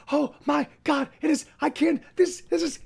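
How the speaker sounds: high, breathless excitement; overexcited dog. Retold or imitated. Owner voicing her puppy thrilled to see her. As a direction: voice the dog losing it with joy. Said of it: "she's like".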